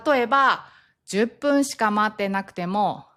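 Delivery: ordinary talk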